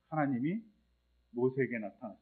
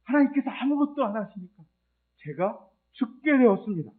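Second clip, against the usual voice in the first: much higher, strained accusation; accusing complaint; 6 semitones higher, 3 dB louder than usual. Retold or imitated. Elijah blaming God for his coming death, high and strained. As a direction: cry out the blame at God. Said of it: "이렇게 따지는 엘리야".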